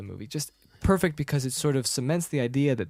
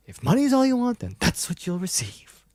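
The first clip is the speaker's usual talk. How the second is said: high-pitched haughty film quote; mock indignation; 10 semitones higher, 3 dB louder than usual. Leia to Han over his reward, high mock indignation. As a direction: scold him, starting high and easing down.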